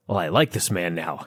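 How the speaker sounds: low, soft mock concession; imagined thought. Voices an imagined grudging thought.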